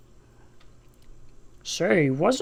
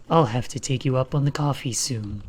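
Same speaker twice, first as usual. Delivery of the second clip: low Shadow voice; 7 semitones lower than usual. Acted.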